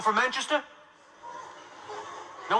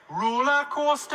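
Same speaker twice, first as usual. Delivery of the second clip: high, drawn-out Geordie; Geordie accent; 9 semitones higher, 3 dB louder, slower than usual. Shown as a demonstration. Comedian demonstrating Newcastle speech for the crowd, Geordie accent. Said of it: "Here's some Newcastle speech".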